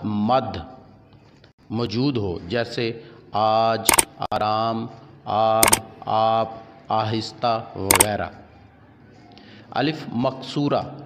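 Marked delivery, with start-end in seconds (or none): 3.3-7.7 first "aa" of आज, आराम, आब, आप, आहिस्ता stretched slowly; drawn-out alif. Shown as a demonstration. Teacher giving alif mamdooda examples, आज and आराम with long drawn "aa". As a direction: hold the opening long "aa" of each word, आज to आहिस्ता. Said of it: "जिस अलिफ को खींच कर पढ़ा जाए".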